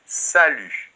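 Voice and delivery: Salut said sa-lu with silent final t; model pronunciation. Shown as a demonstration. Teacher shows how the word is pronounced, silent t. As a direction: say Salut cleanly, no t at the end. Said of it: "il se prononce de cette manière".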